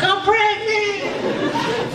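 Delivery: high, slow sobbing squeak as the ex; hammed-up tearful girlfriend voice; mock crying ex. Ex calling crying with news.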